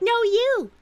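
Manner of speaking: high squeaky mock voice, repeating itself; childish parroting of an opponent; mocking whiny echo. Mocking a debater with a squeaky no you. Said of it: "trying to be like".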